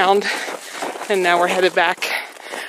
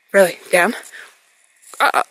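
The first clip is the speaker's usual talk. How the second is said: firm command; faster than usual. Real, not acted. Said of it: "I can correct instantly with my voice".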